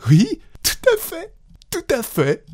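very high, playful voice; amused confirmation. A narrator's reading. Wife still thinking it a joke, confirming brightly. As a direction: chirp it back, still amused. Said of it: "trouvait la chose infiniment plaisante".

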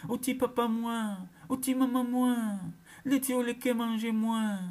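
high, loud terrified cry to her parents. Acted. A frightened girl calls her parents, a loud high wail. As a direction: wail for papa and maman in fear. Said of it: "elle s'écria".

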